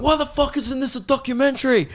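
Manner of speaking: very high, exasperated whine of protest; mock angry outburst. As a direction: demand it in absurd indignation. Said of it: "it's fucking going".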